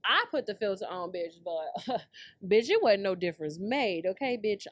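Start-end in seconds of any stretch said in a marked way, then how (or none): none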